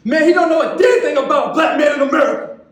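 building to a high, loud outburst; impassioned poetic protest, rising in pitch and force; angry indictment. Acted. Poet rounds on caseworker and counselor.

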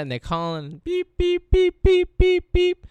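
high, loud mimicked busy-tone beeps; busy signal beeps. DJ imitating a busy 911 line, high beeps. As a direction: beep like a busy phone line. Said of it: "The line is busy".

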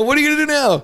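high-pitched sneering dare thrown at the cop; mock dare. Officer mimicking a suspect daring him, high and loud.

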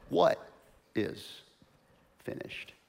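trailing into a whisper on finished; hushed whisper; hushed question. Acted. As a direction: let finished drop to a whisper. Dramatic question.

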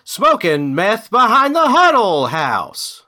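loud, high, drawn-out camp singer impression. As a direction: belt it out slowly as a mock singer. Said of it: "in the [name] voice".

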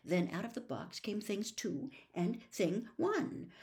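usual speaking voice

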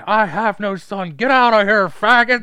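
high, very loud retold bellow; angry shouting. Acting out a father disowning his son, angry shouting. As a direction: start slow and emphatic, build to a yell.

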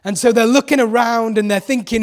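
usual speaking voice

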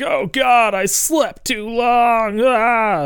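high, drawn-out mock wail; hammed-up despair. Host acts out Ash seeing the ruined city, high mock wail. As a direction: wail like a doomed hero on his knees. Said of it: "he gets to his knees".

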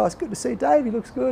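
high, chirpy friendly patter; gushing pleasantries. Retold or imitated. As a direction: keep up the cheery act, pitched high. Gushing as mate paddles away.

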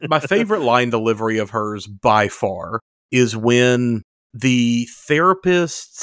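ordinary talk

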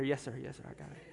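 low, soft, obedient mutter; sick man meekly obeying the pastor; meek yes sir. Retold or imitated. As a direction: answer weakly and humbly.

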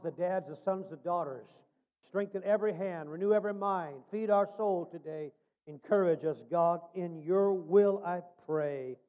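low, earnest prayer voice. Acted. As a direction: pray over them, low and steady. Closing prayer over the congregation.

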